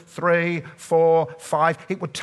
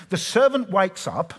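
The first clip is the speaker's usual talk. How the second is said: normal talking voice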